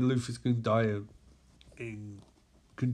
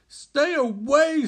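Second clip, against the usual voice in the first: loud, high, drawn-out shout; forceful warning; 13 semitones higher, 8 dB louder, slower than usual. Acted. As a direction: shout to ward it off. Wonder Woman repels the ring.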